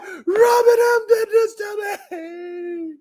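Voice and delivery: high, slow fake sobbing wail; mock crying. Viewer whining to the streamer about Robin M, mock crying. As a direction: ham up a tearful complaint. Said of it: "They're like".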